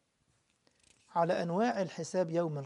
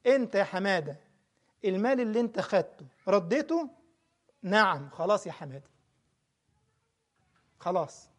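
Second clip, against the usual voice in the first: raised, insistent sermon voice to Hamada; forceful preaching; 3 semitones higher, 8 dB louder than usual. Acted. Preacher pleading loudly with Hamada, forceful preaching. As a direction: press the point home, pleading and firm.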